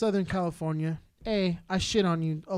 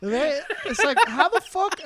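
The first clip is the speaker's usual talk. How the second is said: laughing; 6 semitones higher, 4 dB louder than usual. Real, not acted. Laughing while telling story.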